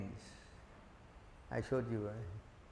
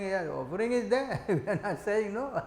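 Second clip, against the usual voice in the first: laughing; 3 semitones higher than usual. Real, not acted. Lecturer laughing while clarifying.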